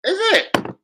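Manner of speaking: high, sarcastic cheer; excited whoop. Real, not acted. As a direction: celebrate mockingly. Mock celebration at transfer.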